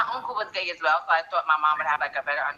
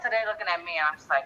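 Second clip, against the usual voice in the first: ordinary talk, nothing marked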